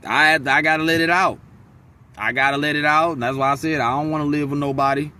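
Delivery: ordinary delivery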